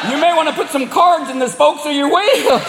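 mock the hybrid like a good old boy; Southern yokels. Gas station local hollering at a hybrid driver, Southern mockery.

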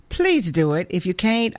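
usual speaking voice

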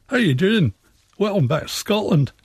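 a light, upbeat mock Scottish greeting; Scottish accent. A local doing a Scottish accent for the radio host. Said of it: "talk with a very different accent".

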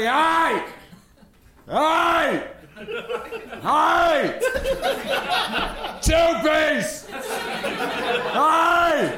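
high, short attention-getting calls; high-pitched yelled demonstration; demonstrated yell. Shown as a demonstration. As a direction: holler to flag down a counter worker. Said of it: "Just yell at him".

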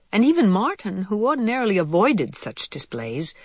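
ordinary delivery, nothing marked

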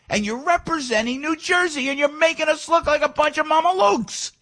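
high, heated rant at the politicians; really fired up, pitch climbing high. Real, not acted. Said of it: "forgive me for being fired up".

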